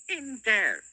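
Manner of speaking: high, loud goblin pointing. Acted. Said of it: "said the goblin".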